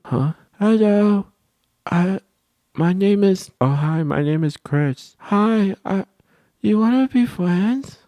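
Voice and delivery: put-on high, slow little girl voice; mock girly voice; girl voicing. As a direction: act out a shy girl asking to be friends. Kid voicing the girl's approach.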